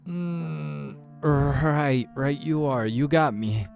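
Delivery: agonized but trying to be polite; pained concession. Acted. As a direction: concede while holding back a cry of pain. Injured man concedes through pain.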